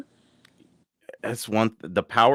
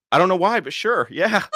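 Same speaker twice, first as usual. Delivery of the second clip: high, giggly, bashful yes; flustered delight; 12 semitones higher, 5 dB louder than usual. Retold or imitated. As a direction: sound flattered and baffled at once. His retold reply to fan.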